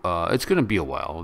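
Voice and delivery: ordinary talk, nothing marked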